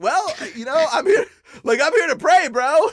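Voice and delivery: giggling mock innocence, way up high; laughing reply. His own reply to the demon.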